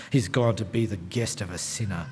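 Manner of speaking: low, quiet disapproving mutter. Acted. People who saw Jesus with Zacchaeus, muttering. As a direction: grumble it under your breath. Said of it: "began to mutter. You know the mutter".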